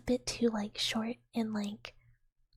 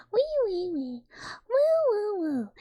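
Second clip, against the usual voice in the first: high, loud falling wee-woo calls; wee-woo squeal; 11 semitones higher, 8 dB louder than usual. Shown as a demonstration. Streamer showing what everyone has heard them go, squealing. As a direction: squeal out three falling notes each time. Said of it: "everyone has heard me go".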